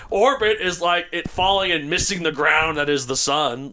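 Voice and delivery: normal talking voice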